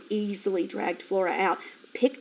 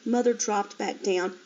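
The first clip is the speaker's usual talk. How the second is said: usual speaking voice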